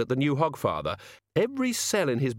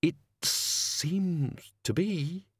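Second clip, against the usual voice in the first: through gritted teeth; 7 semitones higher, much slower than usual. Acted. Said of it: "escaped through his teeth".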